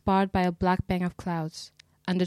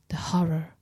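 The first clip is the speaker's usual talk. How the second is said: second whispered horror, more distant; echoing whisper. A narrator's reading. Narrator hears the dusk repeat the dead man's words, fainter whisper. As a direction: let the horror fade like wind. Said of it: "in a persistent whisper".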